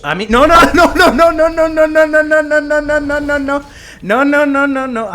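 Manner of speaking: frantic gamer begging the dragon to spare him; panicked pleading. Real, not acted. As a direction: beg the dragon in rising panic.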